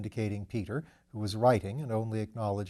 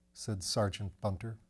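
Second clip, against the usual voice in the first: usual speaking voice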